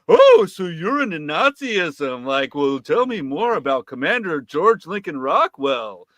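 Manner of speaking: sarcastic interviewer tone; condescending. Retold or imitated. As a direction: talk down to him with fake interest. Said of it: "with a condescending".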